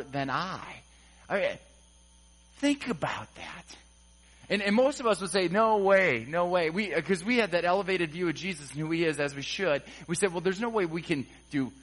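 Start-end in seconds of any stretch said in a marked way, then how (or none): none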